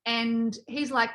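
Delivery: ordinary talk, nothing marked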